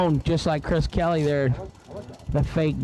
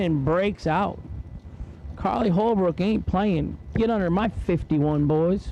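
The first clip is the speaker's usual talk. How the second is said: race announcer; 6 semitones higher, slower than usual. Acted. Announcer to the grandstands, calling each run's numbers in an announcer read. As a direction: drag strip PA announcer calling times.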